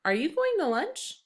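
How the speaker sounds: "Are you going to lunch?" read high with a rising question lift; question tune. Shown as a demonstration. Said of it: "you read it differently than you do when you have a normal period".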